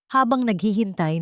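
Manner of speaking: ordinary delivery, nothing marked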